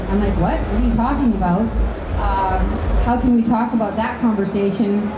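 ordinary delivery

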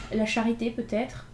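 usual speaking voice

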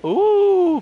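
high, loud gasp of awe; gasping ooh. Real, not acted. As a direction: gasp at the view. Gasp at view.

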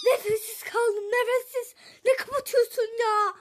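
loud, high breathless laughter. Real, not acted. Mother out of breath from the prank call, laughing. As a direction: gasp it out after laughing.

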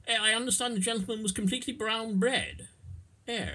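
mock aristocratic Rees-Mogg; Rees-Mogg impression. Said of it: "as Rees-Mogg might put it".

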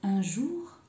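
ordinary delivery

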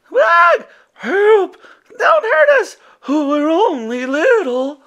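high scared squeals; frightened cries. A narrator's reading. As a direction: beg not to be hurt. Frightened little monsters.

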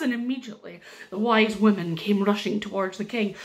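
ordinary delivery

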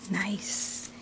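soft whispered aside. Real, not acted. Unboxing a gift, she whispers to herself. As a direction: whisper it to yourself.

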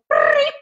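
very high little beep; imitated beep. Host retelling the device connecting, a high beep. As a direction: mimic the device chirping. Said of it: "I heard them go".

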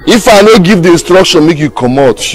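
loud, high-pitched scolding; heated, domineering order; stern warning. Acted. Laying down orders to subordinate.

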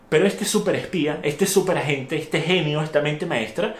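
ordinary talk

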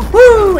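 high, loud "woo" cheer; excited whoop. Real, not acted. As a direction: whoop it out mid-fight. Cheer on getting in.

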